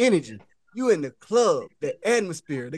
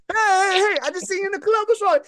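high-pitched hailing voice; comic high, concerned call; eager approach; 9 semitones higher than usual. Acted. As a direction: act out flagging someone down, pitched right up. Voicing man greeting upset girl.

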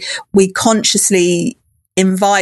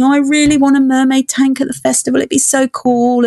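eager, quick, higher-pitched wishing; wistful wishing; 5 semitones higher than usual. Retold or imitated. Festival organiser recalling her own wish, eagerly wishful. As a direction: voice an excited wish in a rush. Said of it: "I was wishing".

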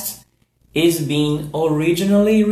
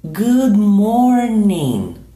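slow, careful Good morning; slow model; 3 semitones higher, 4 dB louder, slower than usual. Shown as a demonstration. Teacher drilling good morning slowly for learners. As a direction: say each word slowly for repeating. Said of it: "So repeat".

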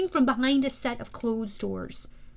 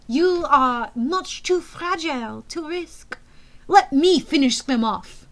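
put-on slow French-accented taunt, rising louder; cruel French accent; 4 semitones higher, 6 dB louder, slower than usual. A narrator's reading. Thorne belittling Luke to the general, cruel French accent. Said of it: "said with a cruel smile".

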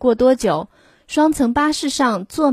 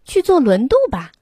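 eager lady; 5 semitones higher, 4 dB louder than usual. A narrator's reading. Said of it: "推着婴儿车的女士说".

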